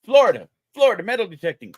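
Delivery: high, loud, quick happy greeting; excited. Real, not acted. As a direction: call out the name with delight. Greeting a viewer in chat.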